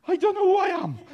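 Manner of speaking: very high, loud, hammed-up wail; comic panic. Visitor put on the spot far from home, comic panic. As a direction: squeal it in mock helplessness. Panicked thought under public questioning.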